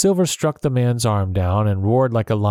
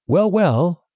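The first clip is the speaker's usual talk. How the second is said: higher, crisp businesslike; briskly; 9 semitones higher than usual. A narrator's reading. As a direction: brush past it and get to work. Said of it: "the doctor said briskly".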